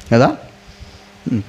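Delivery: usual speaking voice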